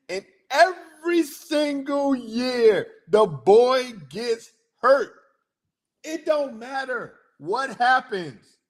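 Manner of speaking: high, slow, incredulous emphasis; high-pitched, drawn-out disbelief; exasperated rant. Real, not acted. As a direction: stretch every word out in exasperation. Exasperated rant about injured quarterback.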